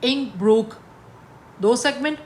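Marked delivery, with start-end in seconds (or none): 0.0-0.7 "इंग Brooke" in two firm beats; broken into syllables. Shown as a demonstration. Teacher marking one foot, "इंग Brooke" loud and syllable by syllable. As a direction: punch out "इंग", then "Brooke", separately. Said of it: "इसको भी हमने तोड़ा".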